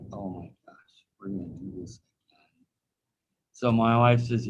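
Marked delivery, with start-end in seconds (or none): 0.1-2.7 whispered in dismay; father realizing the elbow went out again, whispering. Retold or imitated. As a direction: breathe it as a horrified aside. Dad dismayed, reliving the injury.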